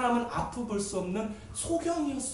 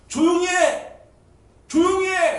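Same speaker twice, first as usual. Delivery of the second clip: loud, high order to hush; acted scolding shout; 9 semitones higher, 7 dB louder, slower than usual. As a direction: shout down the noisy man. Annoyed crowd silences the shouting man. (버럭 입 막는 고함 흉내)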